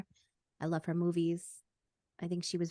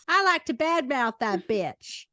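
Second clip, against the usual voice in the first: slow, louder sassy jab; crude sassy example; 3 semitones higher, 5 dB louder than usual. Shown as a demonstration. Demonstrating the crude version to cohost, sassy and slow. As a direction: sneer it like trash talk. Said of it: "instead of saying badmouth".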